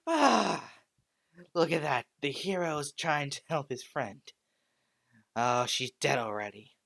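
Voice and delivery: mocking taunt. Villain mocks the grieving hero.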